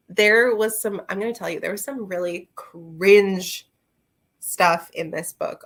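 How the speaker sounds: ordinary delivery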